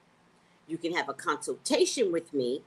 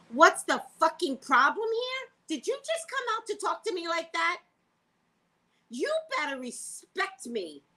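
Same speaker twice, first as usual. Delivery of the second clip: telling off the pharmacist, high and indignant; angry confrontation; 12 semitones higher than usual. Retold or imitated. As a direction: put the pharmacist in her place.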